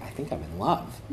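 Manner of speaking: low, hushed admission; shy confession. Retold or imitated. Prince confiding in his handler, shy confession. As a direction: drop to a soft, low voice to confess.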